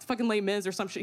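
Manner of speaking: normal talking voice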